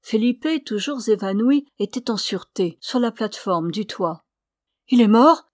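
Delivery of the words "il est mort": loud, frightened question; frightened cry. A narrator's reading. Ramona crying out in fear over Felipe's body. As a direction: blurt it out in fear, much louder. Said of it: "s'écria Ramona".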